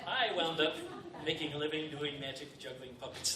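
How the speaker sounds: ordinary talk, nothing marked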